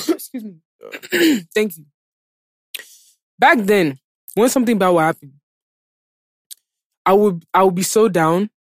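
ordinary talk

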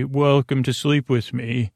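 normal talking voice

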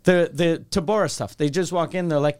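ordinary delivery

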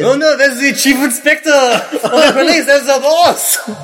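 high French policeman, slow on the boss; shocked French river cop; French accent. Acted. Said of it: "we also have to do French accents".

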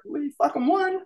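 high-pitched excited retelling of the news; ecstatic news. Retold or imitated. Jesse calling the band with the win, high and excited. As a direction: squeal it like you can't believe you won.

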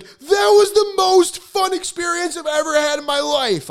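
very high, thrilled squeal; hammed-up high-pitched elation; ecstatic gushing. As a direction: gush at the top of your range, over the moon. Ecstatic call after class.

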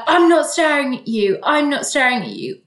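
loud, high defensive denial; mock aggressive denial. Staring man denying it to her, mock angry. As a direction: imitate him snapping back. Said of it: "he started getting aggressive about it".

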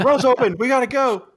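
high, loud call-out; mock announcement. Worker telling the brawlers to move, urgent high shout. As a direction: call it out high to rally everybody.

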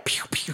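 mock pistol pops; imitated small gunshots; little six-shooter noises. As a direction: fire two toy-gun shots with the mouth. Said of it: "with his little six shooters".

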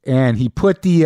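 normal talking voice

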